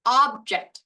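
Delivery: "object" as OB-ject, OB punched loud; stress on first syllable. Shown as a demonstration. Teacher gives first version, a thing, stress on first syllable of "object". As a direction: hit the front of "object", OB-ject. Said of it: "The first version".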